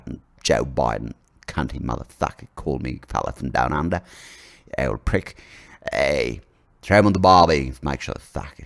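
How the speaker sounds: put-on low grumbling Aussie accent; mock Australian accent. Australian leader cursing the American president, low mock Aussie accent. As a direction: grouse in broad, sweary Australian. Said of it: "Australian vocabulary".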